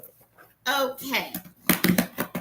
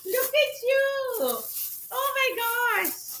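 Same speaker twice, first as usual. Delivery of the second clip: high, slow, delighted coo at a gift; cooing over a purse; 9 semitones higher, 4 dB quieter, slower than usual. Real, not acted. To a new red purse from Keisha, a high slow coo.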